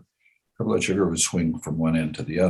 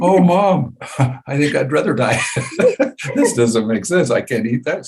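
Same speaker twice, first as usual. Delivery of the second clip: half-laughing whine, a bit higher; laughing mock despair; 7 semitones higher, 4 dB louder than usual. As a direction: laugh through a mock protest. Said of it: "And I'm going".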